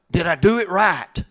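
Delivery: high, loud anxious self-questioning; second-guess yourself, pitch climbing with each question; worried parent doubting themselves aloud; self-judging. Acted. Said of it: "You're always judging yourself".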